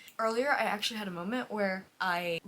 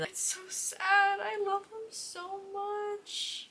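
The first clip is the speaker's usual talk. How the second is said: high, slow, wailing voice; whiny crying voice; 12 semitones higher, much slower than usual. Real, not acted. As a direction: wail it out like sobbing. Grieving characters.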